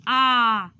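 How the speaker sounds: long open आ, mouth wide and loud; vowel demo. Shown as a demonstration. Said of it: "जैसे".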